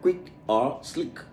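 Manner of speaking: usual speaking voice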